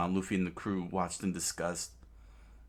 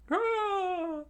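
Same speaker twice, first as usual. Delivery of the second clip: very high short shriek; crowd scream; 4 dB louder than usual. Acted. Subjects raising weapons, one high scream. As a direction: shriek like an angry mob.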